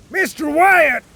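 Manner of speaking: high, loud call across the deck; shouting a name. A narrator's reading. Captain shouting to Mister Wyatt on the sinking ship. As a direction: call him sharply. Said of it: "shouted the captain".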